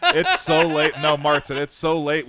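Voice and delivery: can't get through it without laughing. Real, not acted. Telling friends the story, laughing loudly. Laughing retelling of late night.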